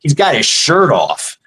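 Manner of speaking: ordinary delivery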